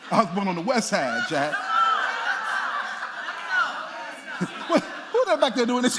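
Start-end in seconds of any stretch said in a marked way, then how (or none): 1.6-4.6 high squealing laugh. Real, not acted. Preacher cracks up at heckle.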